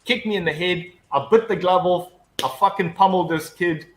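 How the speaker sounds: normal talking voice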